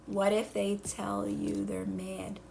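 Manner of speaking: low, soft, slow murmured read; reading a question. Shown as a demonstration. Coach reading a viewer's question under her breath, soft and slow. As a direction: mumble the chat question to yourself slowly. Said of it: "here's Kelly".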